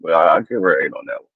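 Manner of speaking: normal talking voice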